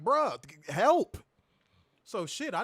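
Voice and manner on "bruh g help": choking plea. Retold or imitated. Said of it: "I'm like".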